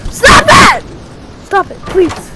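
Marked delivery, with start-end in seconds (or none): 0.1-0.8 1 pleading shout. Real, not acted. Being attacked.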